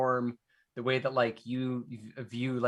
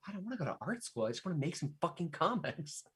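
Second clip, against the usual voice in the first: higher, softer mock protest; protesting art student; 4 semitones higher, 9 dB quieter than usual. Student objecting to art teachers about comics, mild protest. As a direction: plead back lightly, pitched up.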